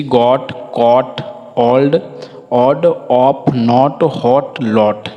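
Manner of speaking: गॉट, कॉट, हॉट spaced out with an open o; short o demo. Shown as a demonstration. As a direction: model गॉट to लॉट one careful word at a time. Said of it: "इस प्रकार से उच्चारण होता है".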